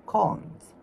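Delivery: usual speaking voice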